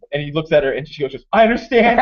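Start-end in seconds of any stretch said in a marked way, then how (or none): 1.3-2.0 high, loud dramatic consent; imitated woman's acceptance. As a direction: play the doomed sweetheart, raised in pitch. Retold tragic acceptance from bride.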